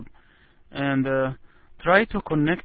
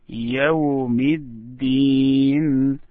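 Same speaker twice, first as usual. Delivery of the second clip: يوم الدين slow, الدين drawn out and stressed; stress on the last word. Shown as a demonstration. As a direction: stretch out الدين for the student. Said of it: "But the last one".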